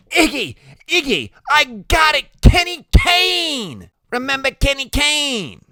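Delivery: very high, loud excited cry; excited Loretta. Acted. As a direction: burst out with a sudden realization. Loretta jumps up, suddenly remembering.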